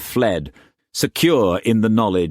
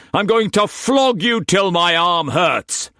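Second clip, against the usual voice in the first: higher, louder raging master's voice; furious master; 12 semitones higher, 4 dB louder than usual. A narrator's reading. Struan raging at Mei Mei before whipping her, furious shout. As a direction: bark orders like an enraged lord.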